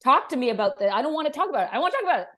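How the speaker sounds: half-joking outburst to shut the topic down; mock angry yell. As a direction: her past self yelling at her partner, hammed up. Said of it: "I'd be like".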